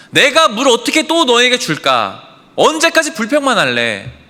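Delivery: high, loud, exasperated outburst; angry rebuke. Acted. Moses to the Israelites demanding water, angry and fed up. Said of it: "그게 바로 욕설입니다".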